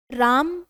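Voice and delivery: राम called loud with a long आ; calling out. Shown as a demonstration. Teacher demonstrating a drawn-out call. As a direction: call राम as if to someone far off. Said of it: "दो मात्रा का समय".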